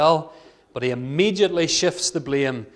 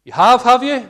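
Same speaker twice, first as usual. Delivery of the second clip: sarcastic Samuel; 7 semitones higher, 8 dB louder than usual. Acted. Samuel catching Saul out, loud high sarcastic retort. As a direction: pitch jumps up high and loud in mock disbelief. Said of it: "says Samuel".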